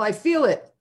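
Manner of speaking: high-pitched excited cry; yelling. A narrator's reading. Excited skipper yells his hunch to the crew, high-pitched. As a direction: call it out with a skipper's conviction. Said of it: "he'd yell".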